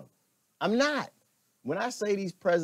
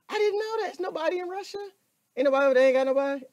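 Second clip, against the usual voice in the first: high, loud, mocking reply; mock amazement; 12 semitones higher, 3 dB louder, much faster than usual. Streamer ridiculing a comment about Russia, high and loud mockery. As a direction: scoff at the fact in a high voice.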